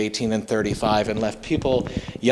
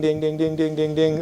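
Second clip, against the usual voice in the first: higher ringing dings; 5 semitones higher than usual. Retold or imitated. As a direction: imitate pottery ringing, pitched up. Pottery ringing all around.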